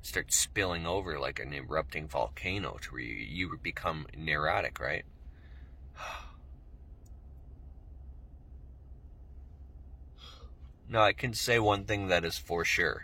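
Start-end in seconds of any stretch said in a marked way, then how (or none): 5.1-10.8 long sigh. Real, not acted. Pausing during breathing exercise.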